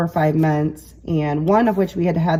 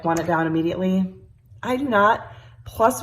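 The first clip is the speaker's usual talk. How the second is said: ordinary delivery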